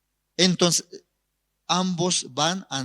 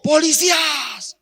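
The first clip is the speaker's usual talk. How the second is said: high, strained acted shout; desperate defiant cry, pitched high; 13 semitones higher, 4 dB louder than usual. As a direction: yell it out to those inside. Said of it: "y gritó".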